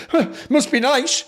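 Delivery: high, put-on sulky voice; mock feminine huff. Host mimicking someone who won't say why they're mad, mock huffy. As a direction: imitate a huffy sulk, pitched well up. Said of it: "mad feminine coded thing where you're not telling us".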